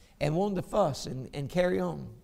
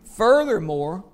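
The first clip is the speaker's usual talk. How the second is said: raised, ringing reading of the verses; pulpit scripture reading; 4 dB louder than usual. Acted. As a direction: proclaim the scripture to the congregation. Preacher reads Hebrews aloud.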